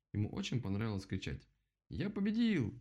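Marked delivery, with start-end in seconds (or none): 1.9-2.7 high, drawn-out victory cry; triumphant yelling. A narrator's reading. Rabbit announces victory across the clearing, yelling. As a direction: yell the win at a high pitch. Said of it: "Он кричал так громко".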